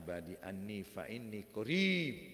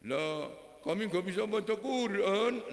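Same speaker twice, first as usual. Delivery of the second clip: Betawi man; 9 semitones higher, 6 dB louder than usual. Retold or imitated. Betawi man admits he cannot read.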